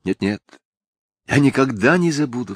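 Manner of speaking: understated exclaimed promise; earnest cry. A narrator's reading. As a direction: protest with sudden feeling. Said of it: "вскричала Софичка".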